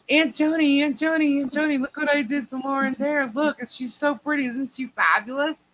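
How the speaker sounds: put-on gleeful child showing off her handiwork; child cousin's voice. Cousin proudly calls to her aunt, high child voice.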